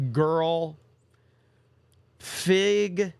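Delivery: put-on "Girl." then a pause, then "Fig." said on its own; words spaced apart. Shown as a demonstration. Streamer reads a chat name word by word, pausing between. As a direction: read each word alone with a gap between. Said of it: "had to kinda separate those words".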